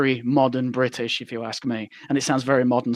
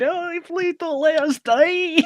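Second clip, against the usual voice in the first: high, slow, hammed-up Scottish accent; whiny Scottish plea, stretched out high; terrible Scottish accent; much slower than usual. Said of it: "that guy with the terrible Scottish accent in the back going".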